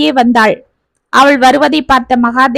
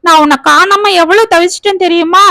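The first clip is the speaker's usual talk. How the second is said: put-on higher, whimpering like a child to his wife; a grown man pleading like a little boy; lightly voiced childlike complaint; 6 semitones higher than usual. A narrator's reading. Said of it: "குழந்தையை போல்".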